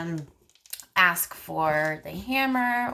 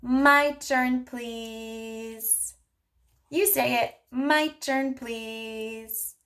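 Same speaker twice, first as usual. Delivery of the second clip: put-on higher, bright-paced modeling voice for a child; speech therapist modeling turn-taking for a child, sing-song; 6 semitones higher, much slower than usual. Shown as a demonstration. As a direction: model it slowly and playfully for a toddler. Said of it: "then you would say".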